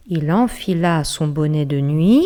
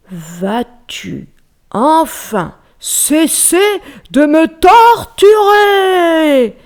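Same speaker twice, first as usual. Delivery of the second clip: very slow, higher, loud anguished yell; bear's howl; 10 semitones higher, 8 dB louder, much slower than usual. Acted. Tortured bear howling at the mouse, slow and loud. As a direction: howl in exasperation. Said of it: "hurla l'ours".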